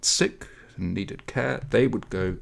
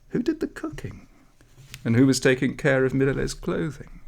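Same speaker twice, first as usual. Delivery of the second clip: high puzzled question; 6 semitones higher than usual. A narrator's reading. Townspeople wondering.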